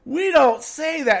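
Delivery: high, loud, slow scolding; firm parent. Retold or imitated. Father telling his daughter off, slow firm scolding. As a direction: lay down the rule slowly. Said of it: "I'm like".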